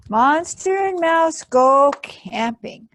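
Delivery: loud high proclamation; announced title. Acted.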